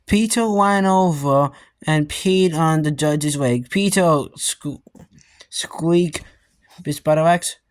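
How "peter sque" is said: plain reading voice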